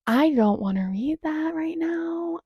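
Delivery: high, slow whine; whiny refusal. Real, not acted. Mock-whining refusal to read.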